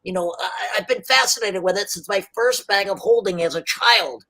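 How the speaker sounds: high, eager inventor voice; high chatty tinkerer; rambling gnome inventor. Acted. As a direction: tell his backstory fondly. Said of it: "He's like".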